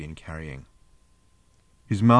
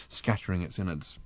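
ordinary delivery, nothing marked

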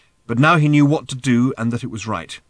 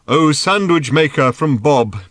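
loud, slow, raised proclamation; solemn pronouncement; 4 semitones higher, 7 dB louder, slower than usual. A narrator's reading. Thrashbarg addressing the sandwich maker from Bob, pronouncing solemnly. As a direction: intone it like a prophet. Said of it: "he pronounced".

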